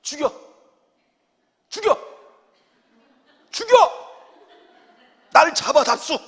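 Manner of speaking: high, loud defiant cry, imitating Moses; hammed-up Moses daring the people to kill him; mock defiance. Moses shouting at grumbling people, mock defiant. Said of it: "이렇게".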